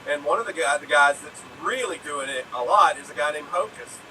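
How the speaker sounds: usual speaking voice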